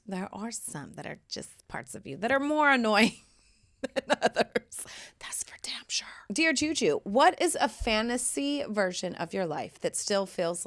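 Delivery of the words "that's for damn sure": whispered aside. Real, not acted. Wry aside after laugh.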